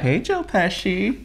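slow, drawn-out playful taunt; teasing. Pretend. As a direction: tease him with the nickname, slowly. Teasing him with nickname.

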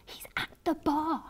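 high, soft breathless whisper; thrilled gasp. A narrator's reading. Fan sees her crush at the bar, thrilled whisper. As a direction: gasp it in giddy disbelief. Repeats it, thrilled at seeing him.

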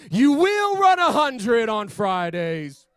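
high, loud, drawn-out declaration; belted imitation of God speaking; God's voice proclaimed. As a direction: proclaim it slowly like a divine command. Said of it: "I remember hearing God say".

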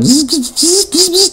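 high, soft buzzing "zzz" like a turbo winding up; turbo spooling buzz. Retold or imitated. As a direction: buzz through your teeth like a spooling turbo. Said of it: "spooling the turbos".

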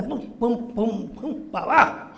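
high, spaced "pum" sounds; vocal sound effect of a descent; thudding steps. Retold or imitated. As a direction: imitate steps thumping down one by one. Imitates descending the gangway.

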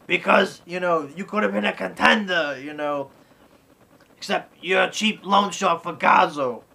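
imitating scolding Mickey. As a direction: lecture him like a gruff old coach.

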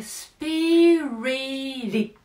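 "スピリティッド" slow, the t tapped like Japanese リ; flapped t. Shown as a demonstration. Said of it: "日本語のリです".

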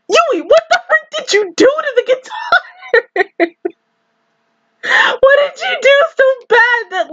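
high squealed question, cracking up; laughing disbelief. Real, not acted. Fan asking Yui about the guitar, laughing in disbelief. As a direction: demand an answer through real laughter.

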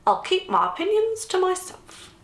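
very high, sing-song unconcern; breezy. A narrator's reading. Nan goes back to her crossword, breezily. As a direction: chirp it as if staying out of it. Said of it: "said Nan breezily".

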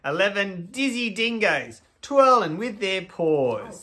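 slow, lilting storybook read; reading to children. Acted. Hosts reading to kids at home, storybook voice.

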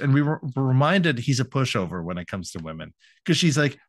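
ordinary talk, nothing marked

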